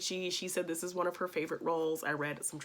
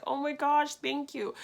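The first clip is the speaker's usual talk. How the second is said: louder, higher burst of gratitude; excited thanks; 10 semitones higher, 5 dB louder than usual. Retold or imitated. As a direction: squeal a thank-you to your idol. Offered a signed photo.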